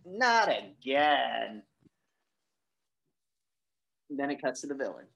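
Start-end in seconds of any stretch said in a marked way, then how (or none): 0.0-1.6 weary groan. Retold or imitated. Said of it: "And he's like".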